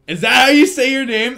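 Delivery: loud, high-pitched disbelief; astonished question. Real, not acted. Streamer asking a viewer about their name, astonished.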